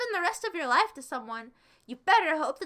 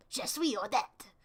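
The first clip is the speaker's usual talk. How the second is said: mock French; 4 semitones lower than usual. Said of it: "I'm not French".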